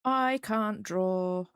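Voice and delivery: each word drawn out slowly; slow dictation of a short sentence; slow deliberate. Shown as a demonstration. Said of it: "That's the sentence".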